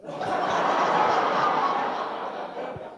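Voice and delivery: silent wheezing laugh; a quiet airy laugh through the nose; unvoiced breathy laugh. Real, not acted. Laughing at son's answer.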